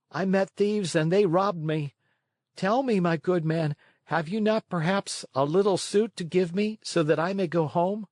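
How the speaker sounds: put-on small wooden boy asking for help; puppet boy pleading. A narrator's reading. Pinocchio pleading.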